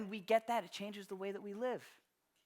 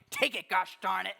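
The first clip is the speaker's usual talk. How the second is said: louder, laughing mock grandma insisting; insistent grandma; 7 dB louder than usual. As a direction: push the money on her with a laugh, grandma style. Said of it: "my grandmother's like".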